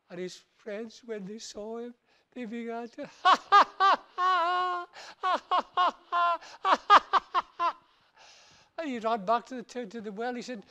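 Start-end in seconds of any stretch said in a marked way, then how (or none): 3.1-7.7 loud high crowd laugh; mocking laughter. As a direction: laugh him down hard. Friends laugh at him.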